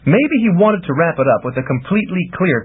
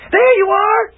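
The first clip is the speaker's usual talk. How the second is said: high, louder triumphant exclamation; exclaiming; 5 dB louder than usual. A narrator's reading. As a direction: catch them out with glee. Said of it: "Iris exclaimed".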